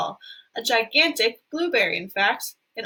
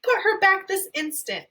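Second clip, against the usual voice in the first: demanding; 9 semitones higher than usual. Acted. Mother demanding.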